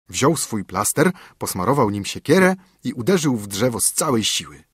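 ordinary delivery, nothing marked

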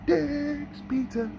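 high, slow amazed repeat; Dick's drawn out high and slow, incredulous; drawn-out disbelief. Real, not acted. Disbelief at the commercial name.

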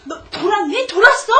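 exasperated scolding. Acted. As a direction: demand it twice, building in force. Mother scolds child next morning.

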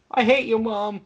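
put-on high, loud bratty teen tantrum; whiny teen voice. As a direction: storm off like a sulky teen. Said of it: "that whiny teen voice".

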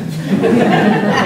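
breathy laugh. Real, not acted. Audience joke aside.

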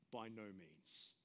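slow, weighty By no means; emphatic. Shown as a demonstration. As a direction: lean hard on each word of By no means. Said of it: "It's emphatic".

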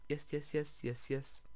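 usual speaking voice

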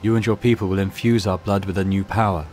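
lightly voiced monotone mutant priest.

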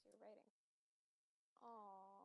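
usual speaking voice

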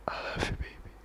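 put-on intimate boyfriend whisper close to the mic. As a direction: whisper it to your girlfriend right at her ear. Whispered goodbye.

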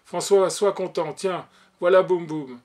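loud, cheerful announcement to a son; delighted father calling out; joyful cry. A narrator's reading. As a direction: call out the good news gladly. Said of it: "cria joyeusement à son fils".

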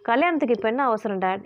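usual speaking voice

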